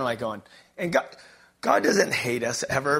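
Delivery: ordinary talk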